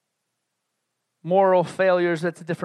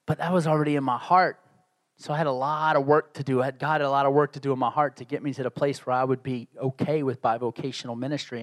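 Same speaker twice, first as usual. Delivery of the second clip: ordinary delivery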